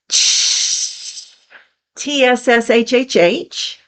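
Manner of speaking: hissing tsshh like water on a griddle; sizzling tsshh. Shown as a demonstration. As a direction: sizzle it like a hot pan. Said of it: "like a drop of water on a hot griddle".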